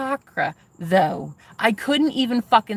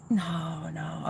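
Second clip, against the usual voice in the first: much softer, gentle no; 5 semitones lower, 11 dB quieter than usual. Retold or imitated. Quoting the guy promising not to judge, much softer. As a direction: murmur it reassuringly.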